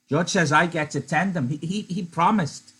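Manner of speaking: put-on Lenny pressing the point like a child; insisting Lenny. Lenny voiced in read-aloud.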